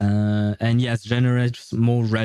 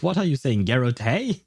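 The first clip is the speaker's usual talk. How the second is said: ordinary talk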